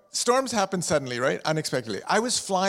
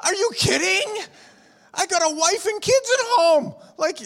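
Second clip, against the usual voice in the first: panicked outburst; 13 semitones higher than usual. Retold or imitated. Passenger in a storm yelling back at the pilot, mock panic. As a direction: shout it in disbelief.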